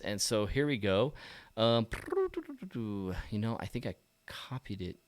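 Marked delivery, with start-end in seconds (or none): none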